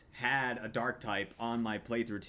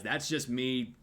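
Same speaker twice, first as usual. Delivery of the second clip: usual speaking voice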